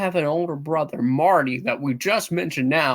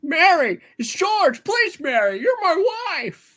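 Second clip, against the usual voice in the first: desperate pleading; 11 semitones higher, 4 dB louder, slower than usual. Acted. Husband begs wife who doesn't know him.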